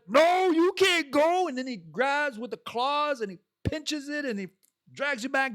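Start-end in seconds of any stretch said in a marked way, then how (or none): 0.0-1.5 high, slow mocking shout; shrill-free high stretched taunt; mock schmuck lobster. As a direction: yell it high and drawn out like a spiteful lobster. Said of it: "some schmuck said".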